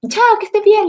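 mock bright farewell; hasty goodbye. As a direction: get rid of him fast. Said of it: "le dije".

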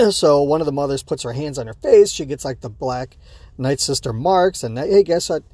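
ordinary talk, nothing marked

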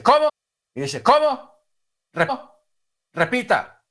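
high, loud disbelieving outburst; startled demand. Retold or imitated. Old man hearing the voice, startled and demanding. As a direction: act out a startled request to repeat.

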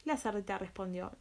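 usual speaking voice

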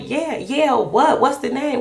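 high, loud, impatient yeah-yeah-what; impatient pushing. Retold or imitated. Wife badgering her husband for the baby's name, impatient. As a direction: push him for an answer, rudely. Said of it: "so I guess I was kinda mean".

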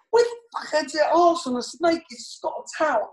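panicking. Retold or imitated. Snake crushing his arm, frantic high panicked shout. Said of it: "And I'm panicking".

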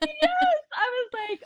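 high, bubbling laughter; laughing through the words. Real, not acted. Laughing to the interviewers about the proposal. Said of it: "'cause I started laughing".

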